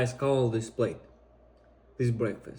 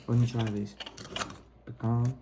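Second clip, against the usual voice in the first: ordinary talk, nothing marked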